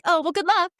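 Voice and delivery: fake-sweet dismissal; catty send-off. Petty retort in story.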